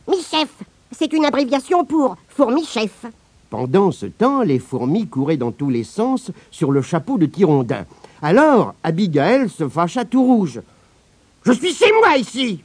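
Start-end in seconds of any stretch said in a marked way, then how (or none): 0.1-3.1 fourmi-chef, voix aiguë et fière. Acted. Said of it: "Comment t'appelles-tu". 11.4-12.6 grogner. Acted. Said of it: "grogna-t-elle".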